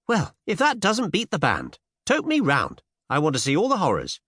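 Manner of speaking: put-on high, eager young woman; thrilled Cinderella. A narrator's reading. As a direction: gush with high-pitched excitement.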